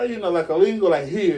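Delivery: ordinary delivery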